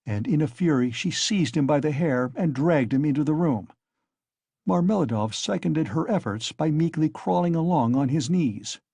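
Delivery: plain reading voice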